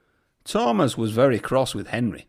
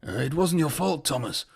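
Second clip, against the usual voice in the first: out of breath. A narrator's reading. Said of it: "catching his breath".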